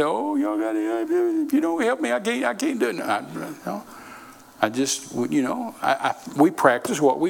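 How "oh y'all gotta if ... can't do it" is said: high-pitched mock crying whine; hammed-up, much higher pleading; whining plea. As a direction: whine and beg like a helpless fundraiser. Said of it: "Don't cry and whine and say".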